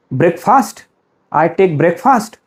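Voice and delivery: "breakfast" as break plus fast; "breakfast" in two full words, break-fast; wrong breakfast. Shown as a demonstration. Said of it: "Wrong, you are absolutely wrong".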